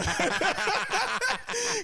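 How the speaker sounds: high, helpless cackle; big laugh. Real, not acted. Recalling nearly hitting his homie, cracking up laughing. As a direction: crack up mid-story.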